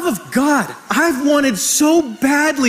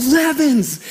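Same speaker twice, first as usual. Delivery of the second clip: higher-pitched, mildly raised coach's call; mock coach's yell; 3 semitones higher than usual. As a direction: imitate a coach yelling a player's surname. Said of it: "And he yells".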